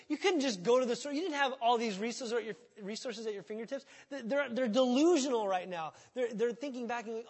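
usual speaking voice

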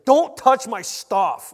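loud, slow, hammed-up scolding; mock angry outburst. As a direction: pretend to tell someone off, booming. Joke misquote of an angry Jesus.

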